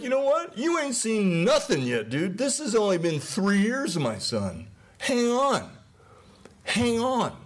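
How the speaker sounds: high, amused, knowing voice of God; God laughing. Retold or imitated. Preacher voicing a laughing God warning the world, high and amused. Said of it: "and he's laughing".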